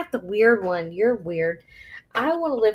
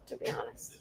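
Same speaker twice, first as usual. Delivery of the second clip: confessional whisper; 19 dB quieter than usual. Real, not acted. Admitting to her co-host she wouldn't cry over Leo, in a soft whisper.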